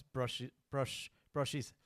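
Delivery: giggling through the words; breaking up while reading; laughing. Real, not acted. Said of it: "I'll just start that again".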